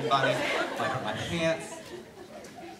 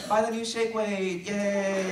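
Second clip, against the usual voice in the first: higher, slow, hammed-up ad read; mock TV commercial; 6 semitones higher, slower than usual. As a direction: sell it like a cheesy infomercial. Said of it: "So here's my first commercial".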